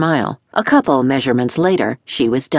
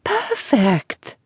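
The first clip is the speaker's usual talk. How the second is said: murmuring dressmaker; 6 semitones higher than usual. A narrator's reading. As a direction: breathe the word in admiration. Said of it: "kept murmuring".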